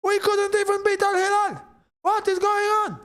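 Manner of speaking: high, far-off mock wail of a fan; imitating a distraught Madrid supporter; upset Madrid fan. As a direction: whine like a gutted Madridista. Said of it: "Can you imagine all the, uh, Ma-, Madridistas".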